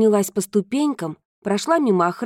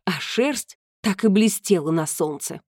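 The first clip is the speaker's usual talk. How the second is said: plain reading voice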